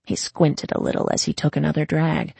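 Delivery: ordinary delivery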